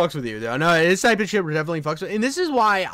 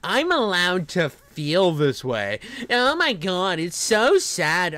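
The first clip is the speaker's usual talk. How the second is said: slow, drawn-out sanctimonious parody; mock virtue signaller; slower than usual. Streamer parodying virtue signallers, slow mock piety. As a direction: stretch each phrase in mocking self-importance. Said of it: "this fucking virtue signal shit".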